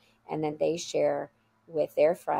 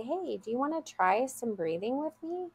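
soft, higher-pitched gentle offer; calm invitation; 6 semitones higher, 4 dB quieter than usual. Retold or imitated. Teacher to an overwhelmed child, calm and gentle. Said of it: "I would very calmly say".

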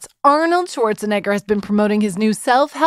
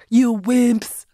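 badly faked Austrian accent; terrible Schwarzenegger accent. Host mimicking classic Arnold for listeners, terrible Schwarzenegger accent. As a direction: toss it off as a bad Austrian strongman. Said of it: "thinking about my accent. That was terrible".

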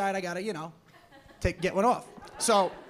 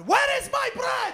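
put-on high, drawn-out shouted demand in Middle Eastern accent; mock aggressive yelling; 14 semitones higher, 4 dB louder, slower than usual. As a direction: yell it like an irate customer. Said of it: "they just fucking yell at you".

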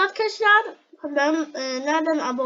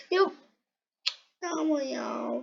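ordinary talk

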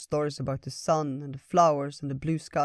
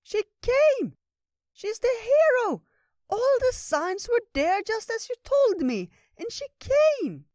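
very high, loud, slow excited little creature; excited squirrel; 6 dB louder, slower than usual. Acted. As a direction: squeal with joy like a bouncing squirrel. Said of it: "jumped up and down excitedly".

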